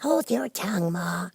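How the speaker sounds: put-on high, impatient young crab; young crab snapping at her mother; a little snappish. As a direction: snap it back at mother. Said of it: "said the young crab a little snappishly".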